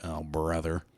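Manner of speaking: a hint of weary oh brother; mock exasperated. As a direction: groan it like you're fed up. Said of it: "I was like".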